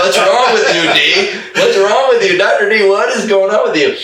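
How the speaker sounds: high, loud laughing mock of puzzled clients; mocking confused clients. Clients teasing the doctor, high laughing voice. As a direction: squeal it like baffled patients, laughing. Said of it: "They're like".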